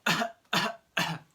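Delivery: loud, deliberate mock coughs; demonstrated cough. Shown as a demonstration. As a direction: cough hard three times to show the word. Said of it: "we could have".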